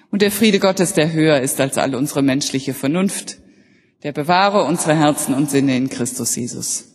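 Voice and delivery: closing blessing. Real, not acted.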